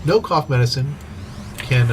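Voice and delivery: ordinary talk, nothing marked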